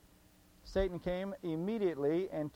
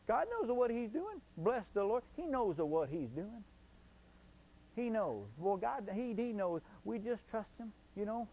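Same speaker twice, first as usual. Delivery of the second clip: sarcastic; 4 semitones higher than usual. Pretend. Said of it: "I'm being sarcastic".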